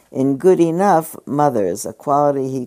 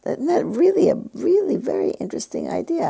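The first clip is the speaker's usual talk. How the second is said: delighted. Real, not acted. Delighted by an idea.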